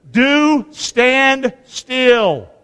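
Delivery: very slow, each word stretched; drawn out. Shown as a demonstration. Preacher teaching point two, drawing it out slowly. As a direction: spell out stand still slowly. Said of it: "Number two".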